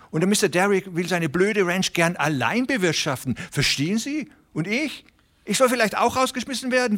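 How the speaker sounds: high, hurried pleading voice of a small worried farmer; anxious little tenant. Acted. Reader voices the tenant Hooven. (aufgeregter kleiner Pächter, hohe, drängende Stimme)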